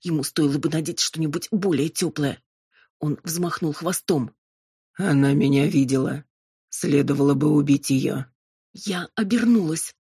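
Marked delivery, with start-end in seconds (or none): 4.9-8.3 low demon voice, menacing; demon, cold threat. A narrator's reading. Demon considering killing the witness, low and menacing. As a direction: pronounce it as a cold-blooded demon.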